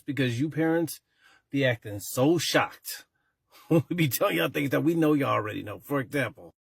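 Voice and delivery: ordinary talk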